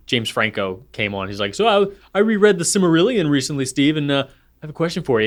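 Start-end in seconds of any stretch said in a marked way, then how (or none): 1.5-5.3 higher, mock-confident celebrity guest; cocky challenger. Guest challenging Colbert on Lord of the Rings, cocky. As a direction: pitch it up with playful swagger. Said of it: "he's acting all, like, cocky".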